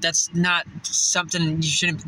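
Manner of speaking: ordinary delivery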